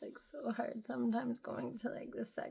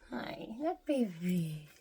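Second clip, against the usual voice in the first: ordinary talk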